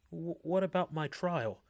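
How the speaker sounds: nervous, stammering question; anxious. A narrator's reading. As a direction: let the worry crack through. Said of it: "anxiety tracing her voice".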